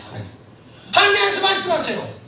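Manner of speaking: normal talking voice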